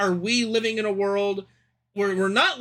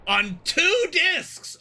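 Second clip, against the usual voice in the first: excited; 9 semitones higher, much slower than usual. Real, not acted. Joking about the game's length.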